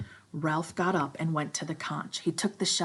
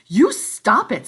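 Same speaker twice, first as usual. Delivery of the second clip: high, loud, slow shrill command; shrill Piggy; 6 semitones higher, 14 dB louder, slower than usual. Acted. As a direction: snap it shrilly. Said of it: "said Piggy shrilly".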